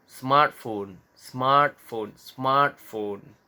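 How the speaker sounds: "smartphone" with SMART hit hard; stress on first syllable. Shown as a demonstration. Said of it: "Biasanya di suku kata pertama juga". (tekanan keras pada SMART di "smartphone")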